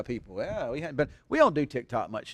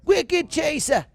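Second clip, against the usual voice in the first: much higher, slow, hammed-up Boston "Wicked Chaser", r dropped as "Chasah"; exaggerated Boston accent; 8 dB louder, much slower than usual. Shown as a demonstration. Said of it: "the Boston accent".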